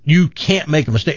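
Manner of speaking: usual speaking voice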